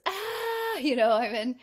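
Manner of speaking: hammed-up yelp of existential panic; mock panic. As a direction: play a freaked-out psyche crying out in alarm. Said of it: "comes back online and goes".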